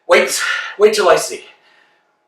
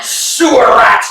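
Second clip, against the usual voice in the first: shouted insult; 4 semitones higher, 5 dB louder, slower than usual. Acted. Exchange of insults.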